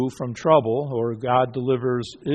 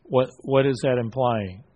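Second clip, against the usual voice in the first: normal talking voice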